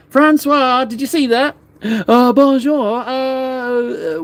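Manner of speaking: high, hammed-up French accent greeting; a playful French host calling out; mock French accent. Streamer showing off his brushwork to French viewer in mock French accent. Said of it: "put an accent on".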